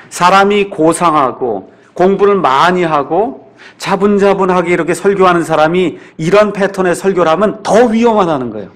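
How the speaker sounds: ordinary talk, nothing marked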